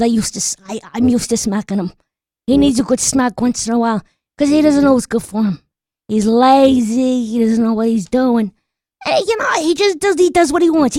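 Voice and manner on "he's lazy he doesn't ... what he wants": normal talking voice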